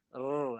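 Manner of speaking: Rrr rolled with vibration; trilled r. Shown as a demonstration. Teacher showing the trilled ra. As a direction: roll the Rrr. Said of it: "ada getaran tu".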